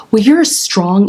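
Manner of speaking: ordinary talk, nothing marked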